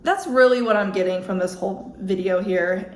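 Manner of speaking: ordinary talk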